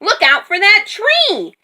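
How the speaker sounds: high, urgent warning cry; alarmed, high-pitched yell. A narrator's reading. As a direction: shout a sudden warning. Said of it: "Moo yelled".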